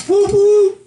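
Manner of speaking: loud, high whoop; excited whoop. Real, not acted. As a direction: cheer it out loud.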